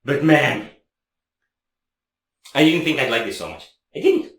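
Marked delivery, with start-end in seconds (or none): 3.9-4.3 high squeal. Real, not acted. Repeats in disbelief, squeaky high.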